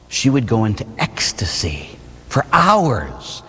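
whispered, breathy awe; awed whisper; hushed whisper. Real, not acted. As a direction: whisper it as if in rapture. Describes saint's rapture.

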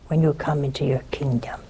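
hushed, pleading whisper. Retold or imitated. The thief begging the Lord, whispered. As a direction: breathe the plea softly.

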